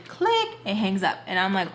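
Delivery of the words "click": high-pitched "click"; imitated hang-up click. Teller imitates him hanging up the phone, high click. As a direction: pop the word out high like a sound effect.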